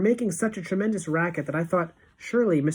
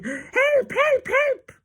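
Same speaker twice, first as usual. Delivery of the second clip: shrill, much higher cries for help; mock panicked yell; 4 dB louder, much slower than usual. Witch mimicking teachers on desks, mock terrified shrieks. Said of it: "yelling, 'Help".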